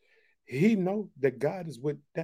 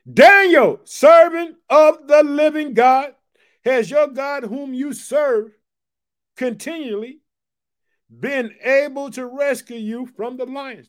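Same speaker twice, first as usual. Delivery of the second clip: higher, much louder, slow anguished cry of a name; a king crying out in anguish for his servant; anguished call; 9 semitones higher, 11 dB louder, slower than usual. Acted. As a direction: call out to Daniel in the den in anguish. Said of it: "In an anguished voice".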